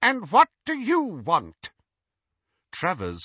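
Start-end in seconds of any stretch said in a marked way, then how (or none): 0.0-1.7 high, haughty contempt; contemptuous. A narrator's reading. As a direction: look down on him with scorn. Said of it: "with as much contempt as she could muster".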